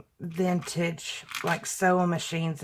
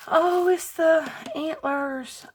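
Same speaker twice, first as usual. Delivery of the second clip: delighted; 10 semitones higher, slower than usual. Real, not acted. Delight at a found item.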